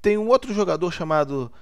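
ordinary talk

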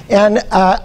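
ordinary talk, nothing marked

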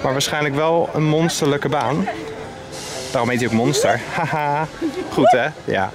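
normal talking voice

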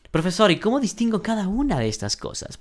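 high, fast eager student. Pretend. Teacher playing a student asking the professor.

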